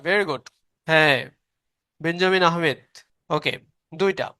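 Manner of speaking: ordinary talk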